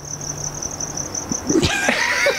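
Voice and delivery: high breathy giggle; laughing in disbelief; burst of laughter. Real, not acted. Laughing at the JetBlue color name.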